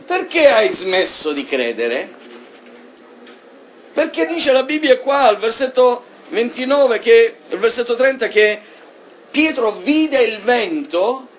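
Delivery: high, loud preaching climax; fervent sermon peak; impassioned preaching. Acted. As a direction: drive the point home to the congregation.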